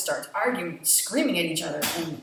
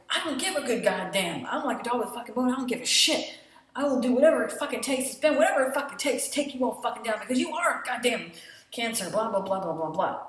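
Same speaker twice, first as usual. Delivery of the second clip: louder, higher, fast defiant tirade; defiant rant; 4 semitones higher, 4 dB louder than usual. Retold or imitated. Faye vowing to take them down, defiant rant. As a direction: swear your defiance all out.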